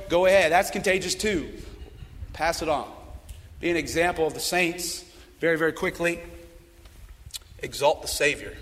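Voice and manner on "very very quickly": ordinary talk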